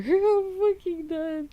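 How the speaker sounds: high, slow, laughing voice; cracking up. Real, not acted. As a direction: squeeze it out slowly through laughter. Cracking up at wheelchair joke.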